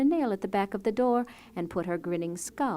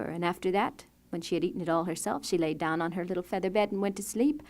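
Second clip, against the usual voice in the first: ordinary delivery, nothing marked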